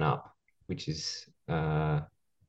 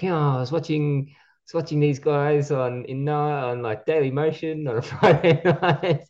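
high-pitched impression of broken English with a Japanese accent; 8 semitones higher than usual. Mimicking a Japanese wrestler's broken English, voiced much higher. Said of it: "Japanese, broken English".

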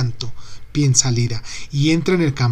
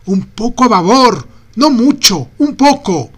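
high, loud urgent yells; shouting; 8 semitones higher, 7 dB louder than usual. A narrator's reading. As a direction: call each correction sharply.

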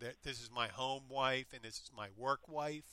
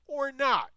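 or not, much higher and louder; stressed punchline; 12 semitones higher, 10 dB louder than usual. Pretend. A man jokes about Ripley's title, hitting not high and loud. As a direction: land the not as the joke.

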